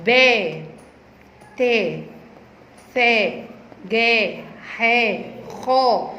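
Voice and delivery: با، تا، ثا as separate syllables with drawn-out aa; alif-second sound drill. Shown as a demonstration. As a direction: model each consonant opening into long aa, paused between. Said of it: "نخلي الألف صوت التاني".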